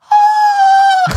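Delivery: high loud panicked scream; mock inner scream, high and loud; silent inner scream. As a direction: scream inside at the shock. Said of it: "like, in my head".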